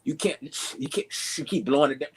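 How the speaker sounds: ordinary talk, nothing marked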